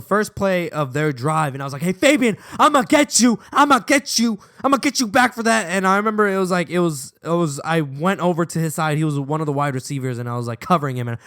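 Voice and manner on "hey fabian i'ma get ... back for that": higher, louder taunting call; mock threat. Player calling to Fabian for revenge, mock threatening shout. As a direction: call out revenge, playfully overacted.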